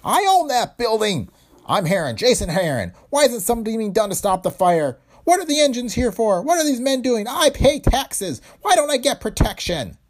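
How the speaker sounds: high, loud, outraged ranting owner; shrill indignant shout. A narrator's reading. Factory owner protesting his burning building, high shrill shout. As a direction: rant at the firemen, voice cracking high with outrage. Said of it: "The agent heard a shrill voice".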